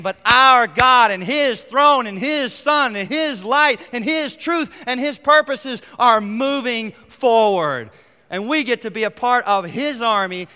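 loud, high, slow sermon proclaiming; preaching at full force. Acted. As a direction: thunder out the victory of the kingdom. Preaching climax on God's kingdom.

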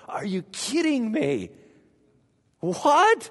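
rising, astonished exclamation; amazed disbelief. Retold or imitated. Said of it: "and you're like".

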